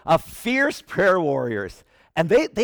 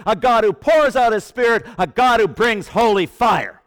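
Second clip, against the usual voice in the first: loud, ringing pulpit declaration; full-force preaching; 4 semitones higher, 4 dB louder than usual. Acted. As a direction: build each line like a rally cry.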